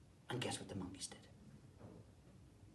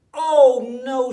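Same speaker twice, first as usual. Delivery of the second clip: very loud, much higher cry of dismay; alarmed cry; 13 semitones higher, 26 dB louder than usual. Acted. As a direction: gasp it out in sudden panic. Granddaughter finds hats gone.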